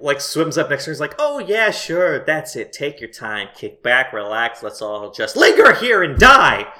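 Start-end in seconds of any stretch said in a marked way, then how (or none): 1.2-6.7 mock-agreeable, building to a loud finish; sarcastic penguin. Penguin nags Melody mid-theft, heavily sarcastic. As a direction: lay on false patience, then burst out on the end. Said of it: "it's a good read".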